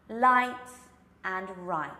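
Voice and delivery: "Light" loud with an open L, then "right" with a curled R; L versus R. Shown as a demonstration. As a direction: contrast an open L and a rounded R. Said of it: "let's try that again".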